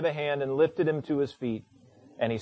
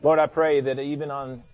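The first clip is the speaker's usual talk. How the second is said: low, hushed prayer voice; 5 semitones lower than usual. Real, not acted. Prayer begins, soft with music.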